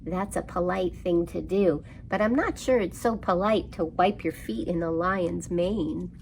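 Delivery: usual speaking voice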